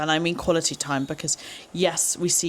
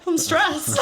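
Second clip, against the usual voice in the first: much higher, louder laughing "I'm stressed"; mock housemate, laughing; 11 semitones higher, 4 dB louder than usual. Retelling the housemate's confused answer, laughing. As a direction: laugh through the housemate's puzzled reply. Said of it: "she's like".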